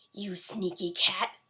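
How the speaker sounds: slow, seething witch voice; yelling witch. A narrator's reading. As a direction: spit it out at the cat, slowly. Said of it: "yelled Bony Legs".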